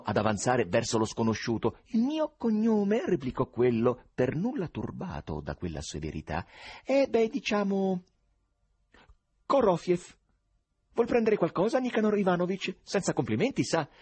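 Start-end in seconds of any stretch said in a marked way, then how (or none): 1.9-3.0 acuto, lento, sornione; Korofiev imperturbabile. Acted. Voce di Korofiev. 6.8-13.9 acuto, lento, sornione; Korofiev imperturbabile. Acted. Voce di Korofiev.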